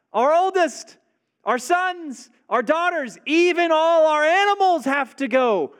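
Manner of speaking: high, loud, resolute declaration; firm proclamation. Acted. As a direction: declare it like a prophet who won't budge. Moses answers Pharaoh firmly.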